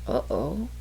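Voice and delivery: a fast unvoiced whisper; whispered aside. Real, not acted. Confessing to the quiz host in a quick whisper. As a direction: whisper it quickly under the breath.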